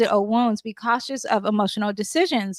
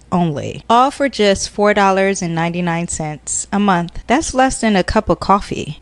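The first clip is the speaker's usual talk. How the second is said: ad read; 3 semitones lower, 7 dB louder than usual. Acted. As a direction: sell it like an infomercial. Mock sponsor ad read.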